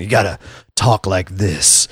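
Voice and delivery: low, gruff wrestling promo voice. Retold or imitated. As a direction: drop into a low wrestler growl. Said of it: "use your wrestling voice".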